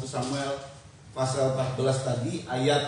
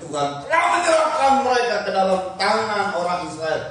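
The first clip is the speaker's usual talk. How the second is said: raised, forceful victory cry; loud proclamation; 3 semitones higher, 6 dB louder than usual. Acted. Jonathan declares God's victory to his bearer, loud proclamation.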